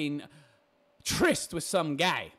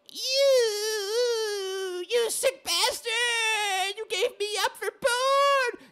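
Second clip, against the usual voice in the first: high, drawn-out mock wail; shaming wife; 14 semitones higher, much slower than usual. Mocking a wife who shames her husband, wailing. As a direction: screech it slowly like a wronged, whining wife. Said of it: "then she shames him and tells him".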